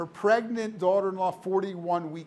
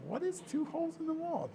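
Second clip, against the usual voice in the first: bewildered; 12 semitones higher, 6 dB quieter than usual. Retold or imitated. Father's bewildered thought, retold.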